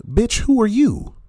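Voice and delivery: loud, very high-pitched challenge; indignant outburst. Real, not acted. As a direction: fire back in outraged disbelief, pitch shooting up. Indignant retort to the played clip.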